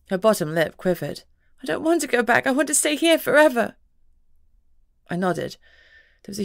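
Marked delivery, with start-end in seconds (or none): 1.6-3.8 put-on higher child's voice, pleading; a young girl begging not to leave; upset little girl. A young girl pleads with her parents to stay.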